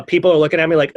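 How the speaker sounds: ordinary talk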